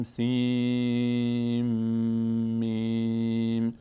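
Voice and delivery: سین، میم drawn out slowly; slow recitation. Shown as a demonstration. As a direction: merge سین into میم, held long.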